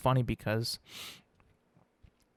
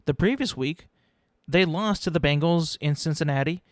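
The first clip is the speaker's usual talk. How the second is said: usual speaking voice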